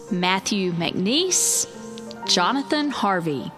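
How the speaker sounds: ordinary delivery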